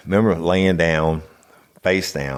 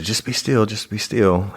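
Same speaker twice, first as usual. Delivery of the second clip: imitated nurse; 6 semitones higher than usual. Quotes nurse urging stillness.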